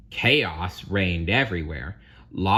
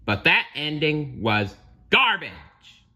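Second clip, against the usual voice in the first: slow, raised, emphatic bird voice; seagull passing judgment; 7 semitones higher, 5 dB louder than usual. Acted. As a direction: drag it out with disdain. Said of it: "the seagull admitted".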